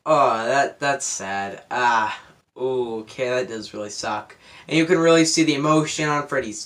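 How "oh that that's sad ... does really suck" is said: soft, low murmur of mild letdown; half-swallow it like a shrug at a sad scene; deflated mock reaction. Reviewer retelling his reaction to a sad scene, softly deflated. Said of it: "but I was like".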